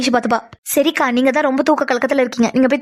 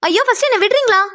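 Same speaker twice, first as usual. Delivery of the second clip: high-pitched, fed-up demand; flustered, annoyed plea pitched high; exasperated; 9 semitones higher than usual. Acted. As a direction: insist on being let go. Demands to be let go.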